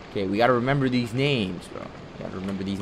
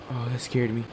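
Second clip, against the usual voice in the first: usual speaking voice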